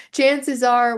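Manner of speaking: laughing. Real, not acted. Laughs at her own phrase.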